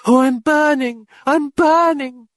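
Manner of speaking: very high, slow moan of pain. Acted. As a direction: draw it out as if burning.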